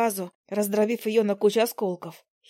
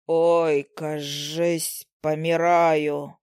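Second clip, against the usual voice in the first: low, slow dying groan; groaning male fly; 5 semitones lower, much slower than usual. Acted. Fallen male fly moans he is dying, low slow. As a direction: moan like a dramatic dying fly. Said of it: "простонал мух".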